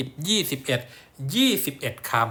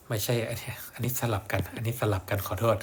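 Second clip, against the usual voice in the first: whisper; 5 dB quieter than usual. Real, not acted. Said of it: "whispered self-correction".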